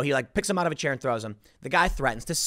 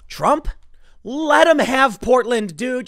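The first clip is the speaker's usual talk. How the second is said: Trump, let 'em have Portland: louder and higher, slow and pushed; exasperated plea; 8 semitones higher, 7 dB louder, slower than usual. Real, not acted. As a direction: call it out, fed up and half-pleading.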